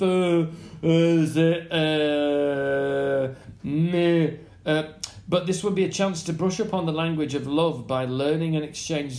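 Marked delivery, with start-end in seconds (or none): 0.0-4.8 long drawn-out French hesitations; halting French. Acted. Proving the poor conversational French, in slow French-accented fillers. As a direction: grope slowly for French words.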